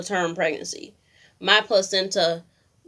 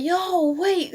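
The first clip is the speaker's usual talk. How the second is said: a sudden high panicked yelp; startled high yelp; 12 semitones higher than usual. Retold or imitated. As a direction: shoot up high in shock, panicked. Startled by her water breaking.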